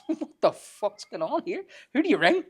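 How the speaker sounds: high, slow incredulous laugh-talk; laughing disbelief. Retold or imitated. Recalling the witch on the ground, laughing high disbelief. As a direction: crack up at the absurdity.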